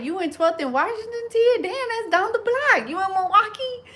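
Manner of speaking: mock surprise. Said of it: "I'm like".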